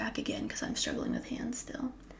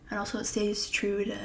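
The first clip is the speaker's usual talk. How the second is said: ordinary talk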